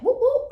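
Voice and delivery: high, loud siren whoops; imitated police siren.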